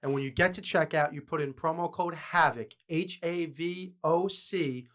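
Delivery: punchy promo read with stretched key words; sponsor ad read. Acted. Host pitching merch drops and a discount code to listeners, ad read. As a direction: sell it to the listeners, spelling things out slowly.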